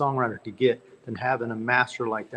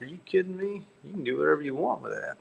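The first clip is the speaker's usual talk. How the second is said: higher, softer, fast amazed answer; retold disbelief, higher but softer; amazed reply; 4 semitones higher, 5 dB quieter, faster than usual. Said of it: "I'm like".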